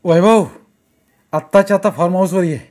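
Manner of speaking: loud, demanding summons; bossy boss on phone. A narrator's reading. Owner summoning Vaibhav by phone, loud and bossy. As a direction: order him over at once. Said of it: "म्हणाला".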